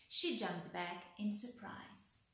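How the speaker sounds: usual speaking voice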